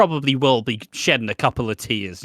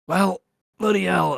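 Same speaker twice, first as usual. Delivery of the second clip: higher-pitched mock alarm; retold startled outburst, pitched up; mock dismay; 6 semitones higher than usual. Martin hearing the paramedic's warning, mock dismay. Retold shock at grim news.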